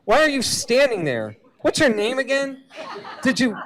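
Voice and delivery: high, loud, frazzled voice hunting for pills; a foggy-brained patient panicking and yelling at the kid; confused chemo patient. Acted. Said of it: "cancer according to reality".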